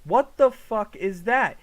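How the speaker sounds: stunned and loud; incredulous outburst. Real, not acted. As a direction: stretch it out loudly, completely baffled. Baffled by katana in trailer.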